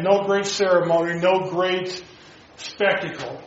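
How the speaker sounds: ordinary talk, nothing marked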